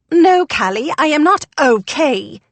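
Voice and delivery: high, loud rebuke landing witheringly on "okay"; withering stress on OKAY. A narrator's reading. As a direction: come down hard and scornfully on "okay". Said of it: "She placed a withering emphasis on the last word".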